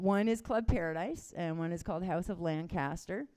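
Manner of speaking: ordinary talk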